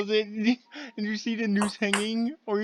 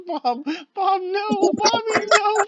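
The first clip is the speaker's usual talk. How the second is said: high-pitched squealed imitation of a plea; imitated panicked pleading; 12 semitones higher, slower than usual. Player retelling friends' cries to Bob, high mock pleading. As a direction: squeal it high, close to crying with laughter. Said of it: "all I heard was".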